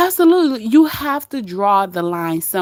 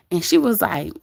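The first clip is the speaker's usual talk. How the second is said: ordinary talk, nothing marked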